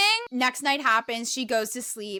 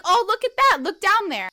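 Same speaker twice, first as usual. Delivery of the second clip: high, loud imitation of an eager man; excited urging; 8 semitones higher, 7 dB louder than usual. Sensei urging her to look in the hole, excited. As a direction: raise the pitch and volume, urging someone to look.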